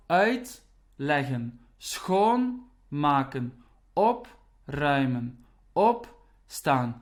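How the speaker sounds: "uitleggen", "schoonmaken", "opruimen", "opstaan" said slowly with the first part, the prefix, hit hard; slow teacher demo punching each prefix; stress on the prefix. Shown as a demonstration. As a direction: lean on uit, schoon and op, drawn out. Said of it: "the stress will be on the prefix".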